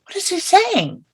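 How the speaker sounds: high, curious question to herself; puzzled wondering. Retold or imitated. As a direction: strain to catch words you can't hear. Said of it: "was like".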